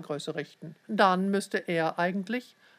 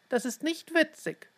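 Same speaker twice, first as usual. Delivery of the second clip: much higher, mildly indignant; reproving; 10 semitones higher than usual. A narrator's reading. Mother to twins playing blind, reproving. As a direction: reprove them in a high voice. Parents scold the pretending twins.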